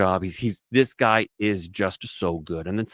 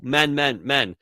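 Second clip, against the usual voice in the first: ordinary talk, nothing marked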